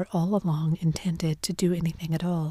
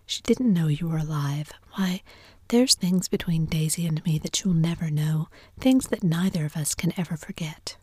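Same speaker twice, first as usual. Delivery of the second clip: ordinary delivery, nothing marked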